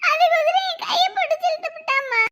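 tearful complaint to mother; whimpering account; crying. Acted. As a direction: pour it out between sobs. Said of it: "நீ அழுவாத".

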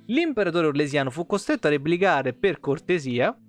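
normal talking voice

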